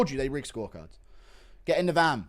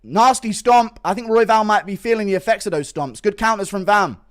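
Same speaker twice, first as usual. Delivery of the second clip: excited fight commentary; live play-by-play; 5 semitones higher, 10 dB louder than usual. Real, not acted. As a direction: call the action live.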